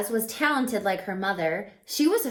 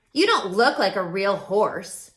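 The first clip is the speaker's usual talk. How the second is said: louder taunting horse voice; mocking horse; 6 dB louder than usual. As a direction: sneer it like a snooty horse. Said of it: "one said".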